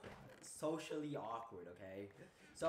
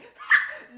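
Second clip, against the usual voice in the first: loud burst of laughter; 24 dB louder than usual. Real, not acted. Laughs at own awkward line.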